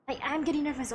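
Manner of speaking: ordinary talk, nothing marked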